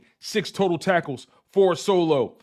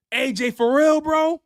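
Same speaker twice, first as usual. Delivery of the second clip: incredulous question; 6 semitones higher than usual. Real, not acted. Disbelief at friend's big win.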